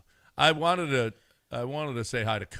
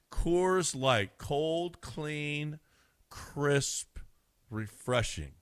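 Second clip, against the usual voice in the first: ad read; 3 dB quieter, much slower than usual. Acted. Live ad read for sponsor.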